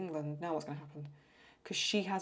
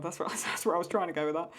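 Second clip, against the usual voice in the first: amused laugh through the words; giggling at her own muddle; laughing, a touch louder; 5 dB louder than usual. Real, not acted. Laughing at dictation mishap.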